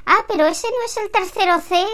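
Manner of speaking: voz de señora mayor, mucho más aguda; señora despistada. Acted. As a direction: pregunta confusa y aguda de vieja. (señora que se ha equivocado de piso, aguda y despistada)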